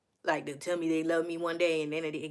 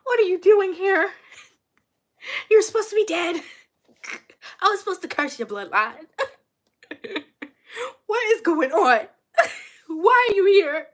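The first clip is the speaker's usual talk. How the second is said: much higher and louder, laughing disbelief; a hammed-up gasp at a ghost come back; mock shocked; 11 semitones higher, 6 dB louder than usual. Seeing the man she wrote off text her, shocked laughter. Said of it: "And I said".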